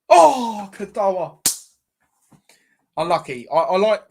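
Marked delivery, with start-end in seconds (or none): 0.1-1.4 near-miss groan. Real, not acted. Shot just missed.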